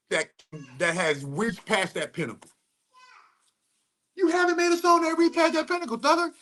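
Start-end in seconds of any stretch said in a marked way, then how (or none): 4.2-6.3 high light voice. Retold or imitated. Repeats line in high light voice.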